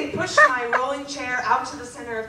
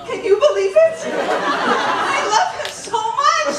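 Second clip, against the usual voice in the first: gushing; 12 semitones higher, 5 dB louder, slower than usual. Real, not acted. Gushing about her teacher crush.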